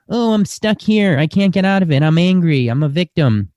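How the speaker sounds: hint of a stuck, sulking victim; mock victim voice. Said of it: "I'm not making fun of that".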